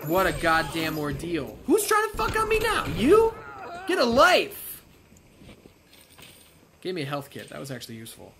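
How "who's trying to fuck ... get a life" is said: higher, louder, fast angry yell; angry outburst. Real, not acted. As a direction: snap at the attacker. Shouting at attacking enemy.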